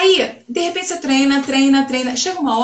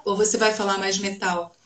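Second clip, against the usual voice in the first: "Ou você vai falar mais metal?" lower and softer, darker placement; lower, softer demo; 4 semitones lower, 5 dB quieter than usual. Shown as a demonstration. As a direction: drop the voice down and back, softer. (voz mais grave e baixa)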